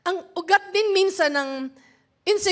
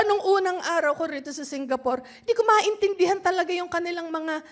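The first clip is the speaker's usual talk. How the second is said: ordinary talk, nothing marked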